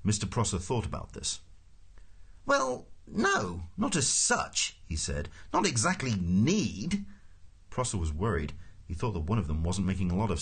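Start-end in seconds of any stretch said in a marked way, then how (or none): 2.4-4.8 high, slow hesitant official; flustered official. Acted. Prosser answers Ford after thinking about it, flustered and high-pitched. 5.5-7.0 high, slow hedging; flustered official. Acted. Prosser qualifies his answer to Ford, flustered and high.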